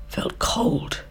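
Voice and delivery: hushed, shivery whisper. A narrator's reading. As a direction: breathe the words out cold and small. Narrating grasshopper's misery.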